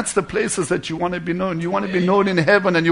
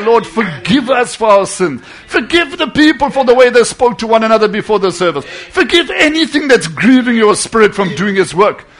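raised, pleading prayer voice; fervent intercession; 4 semitones higher, 5 dB louder than usual. Retold or imitated. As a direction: plead urgently with God for forgiveness. Retold fervent intercession in basement.

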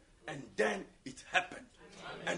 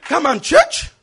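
very loud, high, impassioned sermon; fiery preacher driving each phrase home; full-force preaching; 9 semitones higher, 18 dB louder than usual. Real, not acted. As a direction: shout the challenge across the church. Preaches challenge to the congregation.

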